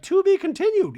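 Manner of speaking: very high, loud squeaky voice; hammed-up high Dorit voice; mock Dorit. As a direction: chirp it like a prim diva. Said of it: "Dorit's like".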